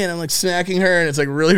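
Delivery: ordinary delivery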